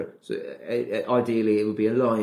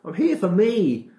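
defiant self-talk; 12 semitones higher, 4 dB louder than usual. Retold or imitated. Answering the inner doubting voice.

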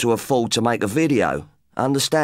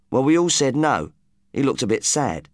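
usual speaking voice

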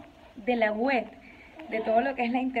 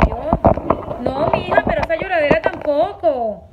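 louder, higher exasperated mom; exasperated scolding; 3 semitones higher, 8 dB louder, slower than usual. Real, not acted. Mother loudly scolding her crying daughter.

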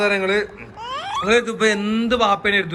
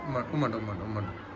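soft coaxing; 11 semitones lower, 12 dB quieter, faster than usual. Real, not acted. Coaxing baby for kisses.

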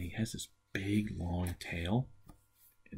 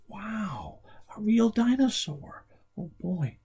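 high, loud wow of wonder; mock amazement; 8 dB louder, much slower than usual. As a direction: marvel at him for the kids. Host marvels at puppet.

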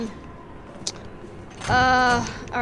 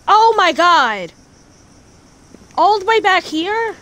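exasperated; 5 semitones higher, 6 dB louder than usual. Real, not acted. Sent back after death.

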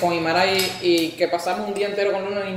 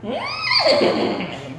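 high squeak. Pretend. Animal sound in game.

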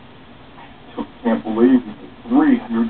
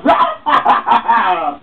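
high mocking laugh; loud taunting laughter; gloating laugh; 12 semitones higher, 3 dB louder than usual. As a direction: laugh in their faces. Robber laughs away.

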